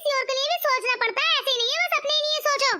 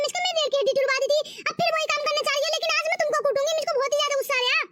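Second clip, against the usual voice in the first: ordinary talk, nothing marked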